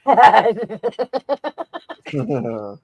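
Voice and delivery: laughing through the words; chuckling slow jab. Real, not acted. Laughing at own joke.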